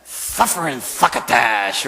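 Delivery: slow, lisping Sylvester impression; Sylvester the Cat voice. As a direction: spit out suffering succotash like Sylvester. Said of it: "What is Sylvester the Cat".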